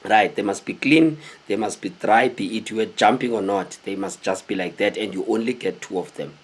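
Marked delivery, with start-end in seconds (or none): none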